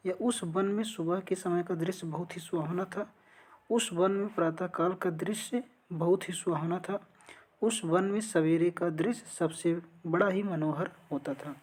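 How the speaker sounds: usual speaking voice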